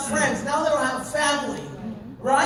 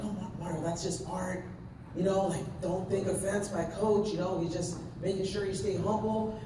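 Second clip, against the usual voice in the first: hushed reassurance; 4 semitones lower, 6 dB quieter than usual. Retold or imitated. Others calming him in story.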